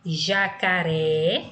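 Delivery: "Jacaré" very slowly, last RÉ drawn out and rising; stress on final syllable. Shown as a demonstration. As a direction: stretch the final "ré" of "Jacaré" upward. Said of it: "a sílaba pronunciada com mais intensidade é a última".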